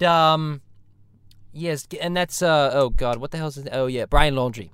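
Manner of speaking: normal talking voice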